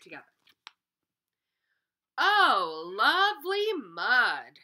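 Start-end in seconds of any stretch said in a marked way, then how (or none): 2.2-4.6 lightly voiced cow, big loud delight; a cow loving the mud, with a loud happy "Oh". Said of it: "said the cow".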